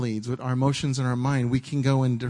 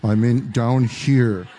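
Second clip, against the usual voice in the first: slow low God voice; 2 semitones lower, 6 dB louder, much slower than usual. Pretend. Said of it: "he wouldn't have a little mouse voice".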